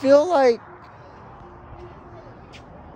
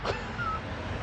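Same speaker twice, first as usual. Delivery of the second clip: squeaky high laugh; 14 dB quieter than usual. Real, not acted. Laughs after retelling.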